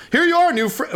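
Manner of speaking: high friendly NPC greeting; cheerful friend voice. Acted. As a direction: call out gladly to a new friend.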